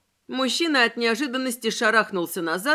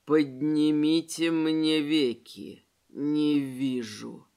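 low, slow, guttural rumble; hoarse guttural monster; 6 semitones lower, much slower than usual. A narrator's reading. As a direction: rumble it from deep in the chest. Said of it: "хриплым утробным голосом".

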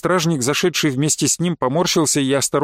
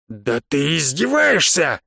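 slow, drawn-out exasperated outburst; angered; slower than usual. A narrator's reading. Бонги to the man mocking him, angered. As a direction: explode at being mocked. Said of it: "разозлился Бонги".

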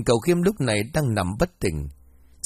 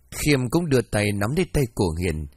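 plain reading voice

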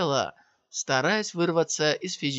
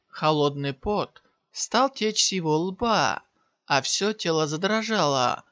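usual speaking voice

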